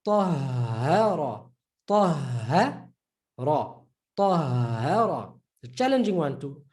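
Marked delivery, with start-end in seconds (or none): 0.0-5.3 Tahara with a long breathed hh; doubled ha. Shown as a demonstration. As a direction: hold the h in Tahara, slowly. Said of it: "You see there, double H".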